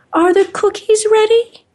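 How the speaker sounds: put-on high, loud little old lady call; grandma calling out. Grandmother calls to her grandchild across the room, high sweet call.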